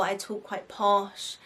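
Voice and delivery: ordinary talk, nothing marked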